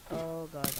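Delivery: usual speaking voice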